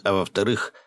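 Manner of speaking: plain reading voice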